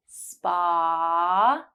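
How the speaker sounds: "Spa" with a long drawn-out ah; held ah. Shown as a demonstration. Teacher drawing out the ah in "Spa" for learners. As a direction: stretch the ah at the end of "Spa". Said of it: "To practice the ah sound".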